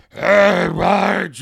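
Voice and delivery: loud Homer-style call; Homer Simpson voice. Retold or imitated. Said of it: "Homer's like".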